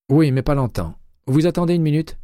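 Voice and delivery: voix de Jay plus aiguë, enjouée; Jay au téléphone. A narrator's reading. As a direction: interlocutrice jouée un ton plus haut, légèrement animée. (Jay, inspectrice, répond à McCaleb au téléphone, voix plus haute)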